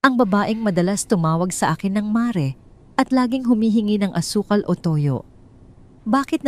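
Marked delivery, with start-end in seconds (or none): none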